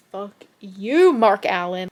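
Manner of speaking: loud, furious curse at him; angry outburst. Retold or imitated. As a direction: snap it out in outrage. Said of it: "I'm like".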